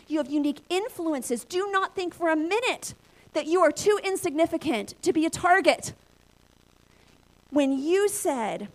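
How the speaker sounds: slowed, emphatic preaching to the crowd. Acted. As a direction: drive the point home to the congregation. Preaches to the audience.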